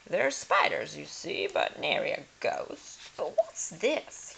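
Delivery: blustering lord. Acted. Lord in the attic.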